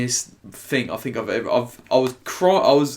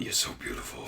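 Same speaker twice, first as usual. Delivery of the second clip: soft, breathy mock come-on; creepy imitation; 11 dB quieter than usual. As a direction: imitate a creepy admirer almost in a whisper. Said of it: "like that the whole time".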